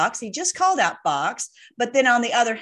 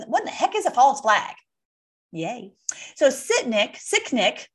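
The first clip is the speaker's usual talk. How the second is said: ordinary talk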